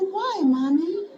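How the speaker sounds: put-on high, slow, loud child's why; a hurt small girl asking her mother; little girl asking. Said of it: "I said".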